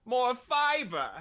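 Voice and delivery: high, slow villain pronouncement; Bane as fitness trainer. Acted. Villain trainer listing health deficiencies, high and drawn-out. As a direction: draw it out like a villain coach. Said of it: "It's like".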